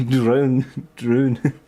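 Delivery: mock Scottish, drawn stretched like drone; playful half-Scottish voice; hammed-up Scottish attempt; bad Scottish accent. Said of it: "I don't have a very good Scottish dialect".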